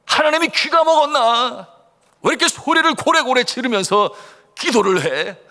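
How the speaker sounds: high, loud mock complaint; imitated disapproving grumble, pitched high and loud; scornful scolding. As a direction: scold them like an annoyed unbeliever. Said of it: "옆에서 핀잔을 주고 그 모습 못마땅하게 여기는".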